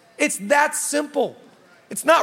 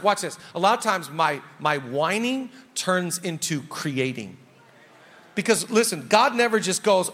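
ordinary talk

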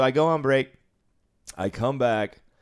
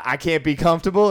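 higher and louder, offended rising question; mock indignant protest; 7 semitones higher, 7 dB louder, faster than usual. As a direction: play the wronged gambler. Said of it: "he goes".

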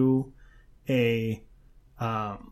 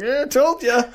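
smug husband; 10 semitones higher, 5 dB louder than usual. Retold or imitated. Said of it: "the husband's just sitting there like".

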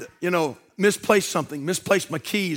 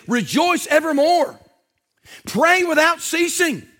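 scripture reading; 7 semitones higher, slower than usual. Acted.

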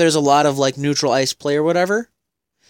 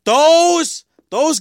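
high, loud hyped-up opener; excited exclamation; 12 semitones higher, 4 dB louder than usual. Real, not acted. Cohost hyping Russian players, excited exclamation. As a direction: launch the word with big excitement.

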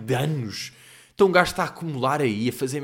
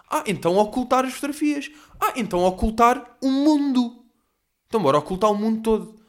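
high-pitched sarcastic exclamations; mock suggestion; 7 semitones higher, 4 dB louder than usual. Vlogger ridiculing the hiding of likes, mock enthusiasm. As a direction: push it up high, mockingly over-keen, escalating.

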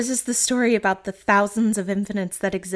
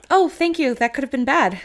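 bright thanks; 6 semitones higher, 6 dB louder than usual. Pretend. Imagined grateful reply, loud.

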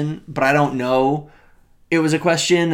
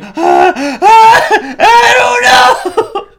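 very high, loud, slow panicked shout; mock panic; 10 dB louder, much slower than usual. Diner retells hyperventilating, much higher and louder.

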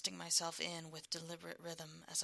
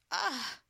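high, loud whimpered ah; high pleasured whimper; happy whimper; 10 semitones higher, 8 dB louder than usual. Acted. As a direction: cry out with pleasure. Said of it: "he whimpered happily".

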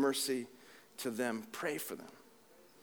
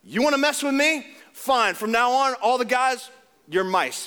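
louder, higher, hammed-up threat; mock vengeful threat; 7 semitones higher, 5 dB louder than usual. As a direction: play the wronged god striking back, tongue in cheek. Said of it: "Jesus, you know, could have said".

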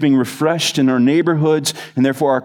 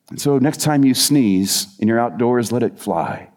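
normal talking voice

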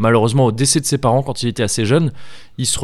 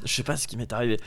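quiet, throat still catching; frog in throat; 11 dB quieter, much faster than usual. Real, not acted. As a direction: talk through a tickly throat. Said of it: "j'ai un truc dans la gorge".

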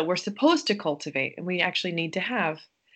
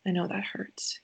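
put-on soft, whispered comfort to a hurt child; hushed, tender sweetheart; 6 dB quieter than usual. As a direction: soothe a crying little one in a hush. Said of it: "We, we say".

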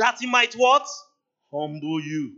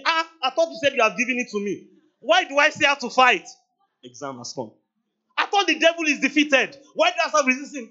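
higher, louder, exasperated protest; indignant complaint; 6 semitones higher, 5 dB louder than usual. Acted. Believer protests to God about still having to fight, exasperated and louder. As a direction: complain like you were promised it was done.

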